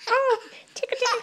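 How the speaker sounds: high, laughing tickle voice; playful tickling with laughter; giggly tickle attack. Real, not acted. Parent tickling laughing child.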